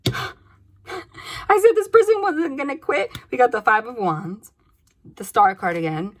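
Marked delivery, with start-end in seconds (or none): 0.1-3.1 gasp, then high, loud, slow amazement; gasping, she can't believe she called it; astonished gasp. Real, not acted. Reader gasping as the cards confirm her prediction, amazed. Gasps at card that proves her.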